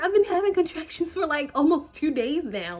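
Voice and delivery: high, strained pleading; panicked. Retold or imitated. Panicked retelling to her mother.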